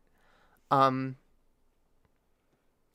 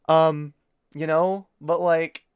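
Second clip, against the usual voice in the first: usual speaking voice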